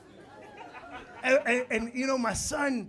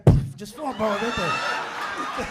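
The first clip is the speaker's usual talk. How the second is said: shouting at him; 9 dB louder, much slower than usual. Retold or imitated. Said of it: "I was like".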